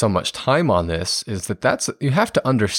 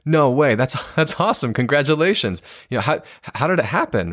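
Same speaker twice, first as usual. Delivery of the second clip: higher, delighted, with a laugh; excited congratulations; 5 semitones higher than usual. Shown as a demonstration. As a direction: share their thrill at the promotion. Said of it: "a more validating response would be".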